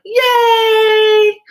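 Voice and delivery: high, loud, long squeal; retold whoop of joy; gleeful cheer. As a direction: shout a drawn-out cheer. Cheering over her favorite food.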